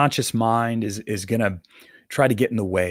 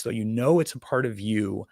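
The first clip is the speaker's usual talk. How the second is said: usual speaking voice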